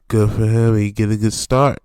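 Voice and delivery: "Good for him" shouted out loud; loud cheer. Real, not acted.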